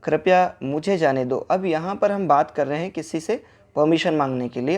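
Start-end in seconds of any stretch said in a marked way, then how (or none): none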